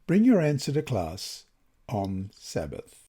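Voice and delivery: usual speaking voice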